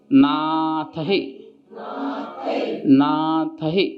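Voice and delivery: नाथ ही said slowly, each sound clear; slow and careful. Shown as a demonstration. Teacher slowing down नाथ ही for students to follow. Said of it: "ऐ की मात्रा भी है और विसर्ग भी है".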